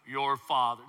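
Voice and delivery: low, booming movie-villain voice; Darth Vader. Acted. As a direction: drop deep for a mock villain impression. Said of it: "the voice of Darth Vader".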